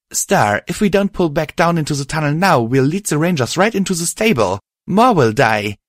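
arguing. Acted. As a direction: argue hard to pull him back. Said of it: "she argued".

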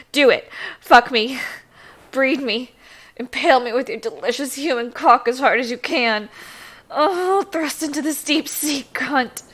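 loud, urgent demands; loud demanding. Acted. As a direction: demand it loudly. Loud demanding climax build.

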